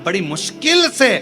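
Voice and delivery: preacher urging listeners to strengthen their faith, high and ringing; preaching at full force. Acted. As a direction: rouse the crowd against empty ritual. Exhorts the congregation.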